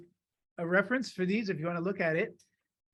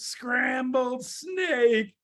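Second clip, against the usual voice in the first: very slow, higher, stretched words; dramatic slow reveal; 5 semitones higher, much slower than usual. Acted. Mouse warns the snake about the favorite food, slow reveal. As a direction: draw out each word with relish.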